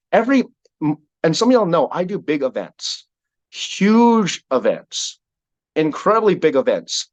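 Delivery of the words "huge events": "huge" stretched long and high; stress on huge. Real, not acted. Speaker boasting of big events, "huge" drawn out high. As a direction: draw out "huge" slowly, pitched up.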